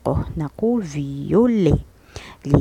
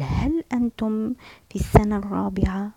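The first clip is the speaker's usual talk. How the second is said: whisper; 4 semitones higher, faster than usual. Shown as a demonstration. Translating the friend's question.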